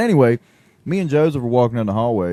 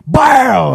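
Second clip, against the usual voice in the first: loud, high gunshot noise; gunshot bang; 14 semitones higher, 9 dB louder than usual. Retold or imitated. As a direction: shout a gunshot sound. Imitated gunshot.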